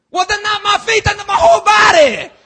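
very high, shouted plea; overzealous Peter. Retold or imitated. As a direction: shout it with over-the-top zeal. Peter overreacting.

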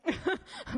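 short rising giggle; a quick amused laugh; light laugh. Real, not acted. Laughs after joke.

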